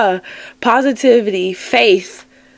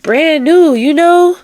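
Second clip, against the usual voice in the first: excited; 7 semitones higher, 6 dB louder, slower than usual. Real, not acted. Excited promise of renewal.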